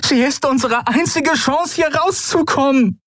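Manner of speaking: very high-pitched, desperate appeal; desperate shouted plea. A narrator's reading. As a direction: plead desperately, pitched right up. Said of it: "rief sie verzweifelt". (hohes, verzweifeltes Rufen)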